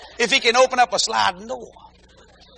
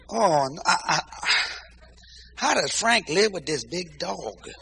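mock weary sigh; 4 semitones lower, 8 dB quieter, slower than usual. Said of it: "And I said".

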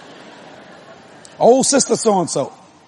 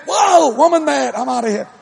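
high startled yelp falling away into a laugh; mock panic; 6 semitones higher than usual. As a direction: play a comically spooked man bolting. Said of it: "he had a fit of carnality".

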